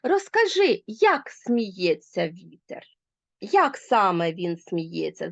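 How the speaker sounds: як read slowly and firmly, weight on як; slow down and lean on як; stress on як. Shown as a demonstration. Teacher showing logical stress on як, slow. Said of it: "виділяється логічним наголосом".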